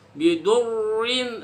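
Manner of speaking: Bidurin drawn out slowly with a thick r; wrong reading. Shown as a demonstration. Teacher showing a mistaken heavy reading. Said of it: "Salah, salah".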